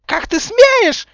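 very high, drawn-out screech; loud, piercing indignant squeal; outraged shriek. A narrator's reading. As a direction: shriek it slowly at full pitch. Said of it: "взвизгнула Белактриса".

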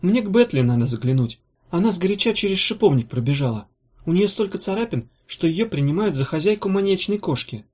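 a hint of a lively storybook woman; lightly voiced chatty heroine. A narrator's reading. Narrator voices Gelata chatting.

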